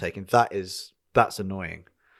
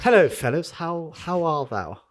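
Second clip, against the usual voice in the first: mock presenter greeting; 5 semitones higher, slower than usual. Mocking the greeting.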